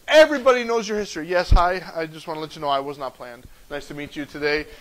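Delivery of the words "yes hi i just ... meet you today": low, soft, deadpan awkward greeting; mock-sheepish introduction. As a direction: introduce yourself low and quiet, comically embarrassed. Acts out embarrassed self-introduction.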